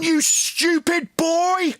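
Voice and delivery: very high, long-drawn angry shout; exasperated yell. Real, not acted. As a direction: stretch the insult out in a high yell. Shouting over opponent's confused answer.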